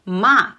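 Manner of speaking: MA falling quickly; fourth tone. Shown as a demonstration. Teacher showing how it's pronounced, "ma" dropping fast. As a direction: make "ma" drop at once. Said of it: "my voice dropped quickly".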